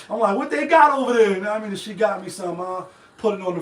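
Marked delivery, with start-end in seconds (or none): 0.4-1.3 higher, louder retold question; eager retold question. Answering his friend's offer, eager raised voice. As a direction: blurt it back, pitched up and loud.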